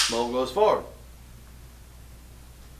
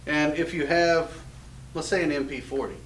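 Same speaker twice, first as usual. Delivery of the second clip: normal talking voice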